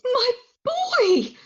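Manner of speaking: high, loud anguished cry; shocked cry. Acted. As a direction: cry out in horror at your son. Mother finds son at club.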